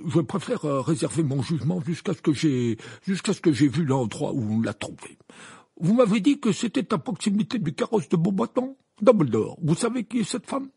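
hedge, then press the question; embarrassed Fudge. A narrator's reading. Minister questioning Dumbledore about the woman, awkward. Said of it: "d'un ton embarrassé".

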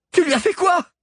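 high, loud horrified cry; shouted. A narrator's reading. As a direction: scream it at him in horror. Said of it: "cria Alix".

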